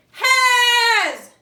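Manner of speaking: a big high shout of has. Shown as a demonstration. Teacher shouting the sight word for kids to copy. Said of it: "Now shout it".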